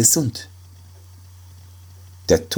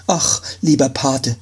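higher, frightened plea; a startled cry to his godfather; 7 semitones higher than usual. Acted. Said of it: "sagte der erschrockene Arzt". (erschrocken, höher)

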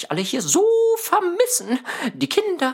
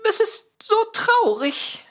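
high, teary voice of a sad cat; cat weeping to Mira about leaving; 8 semitones higher than usual. Acted. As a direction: let the tears break through the words. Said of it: "Oh, du weinst ja".

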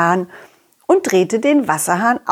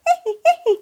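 high squeaky giggle. Acted.

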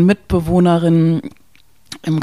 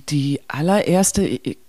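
ordinary talk, nothing marked

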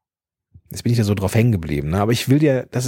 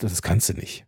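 low, soft, dismissive teacher line; a hint of a stern schoolteacher shutting him down; discouraging teacher; 5 semitones lower, 6 dB quieter than usual. Old class teacher telling the pupil he can't, lower and softer. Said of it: "der gesagt hat".

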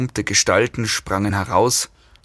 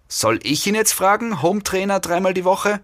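high-pitched woman's voice; high, questioning female character voice; female captor; 10 semitones higher than usual. A narrator's reading. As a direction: lift it into a woman's register. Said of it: "sagte die Frau".

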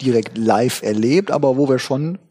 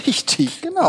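laughing; 11 semitones higher than usual. Real, not acted. Laughing agreement.

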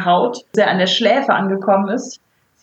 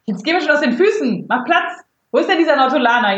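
impatient; 7 semitones higher than usual. Acted. Impatient agent snaps at Jawa.

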